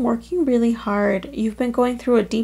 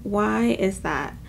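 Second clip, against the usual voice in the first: ordinary talk, nothing marked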